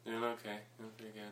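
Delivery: very soft, barely audible mumble; deliberate mumble. Pretend. Guest answering the host's question, deliberately inaudible mumbling. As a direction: mumble it far under your breath. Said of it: "You guys gotta talk".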